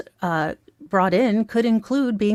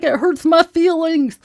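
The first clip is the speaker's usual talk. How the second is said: high, pouty mock-hurt little voice; mock whiny Lori; 9 semitones higher, 5 dB louder than usual. Mocking Lori whining to the judge, high and pouty. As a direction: whine it like a sulking child.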